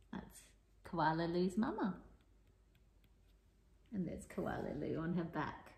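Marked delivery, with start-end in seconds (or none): none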